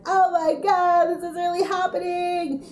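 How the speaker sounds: stretched, high-pitched reenacted shriek; retold excited scream. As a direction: re-enact screaming with excitement, loud at first. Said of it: "And I was screaming".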